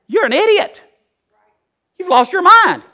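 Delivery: very high, loud incredulous cry; scornful outburst. Acted. Preacher to someone claiming to be righteous, scornful outburst. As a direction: yell it high, as if they're crazy.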